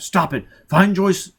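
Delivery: furious barked orders; angry commands. Acted. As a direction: force the child to obey. Foyle shouting at child.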